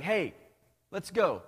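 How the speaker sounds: rally them like a pushy coach dad; lightly voiced no-nonsense dad pep talk; a hint of a firm father. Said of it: "God's like".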